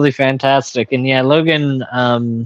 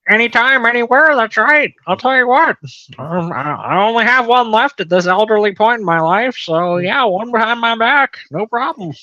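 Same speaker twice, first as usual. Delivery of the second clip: high-pitched, breezy preacher impression; folksy put-on bravado; Ken Hovind; 8 semitones higher than usual. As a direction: brag like an old evangelist, voice pitched well up. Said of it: "your Ken Hovind voice".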